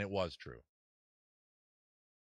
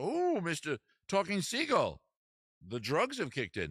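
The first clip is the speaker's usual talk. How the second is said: hammed-up British exclamation, starting high; slow, swooping British accent; 7 semitones higher, 4 dB louder, slower than usual. Man marvelling at a talking seagull, British accent. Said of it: "give you a British accent".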